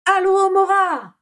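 high, loud, drawn-out shouted spell; cri. A narrator's reading. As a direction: cry out the incantation, stretching it out. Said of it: "cria Bellatrix derrière la porte". (formule criée, aiguë et prolongée)

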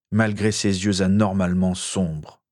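plain reading voice

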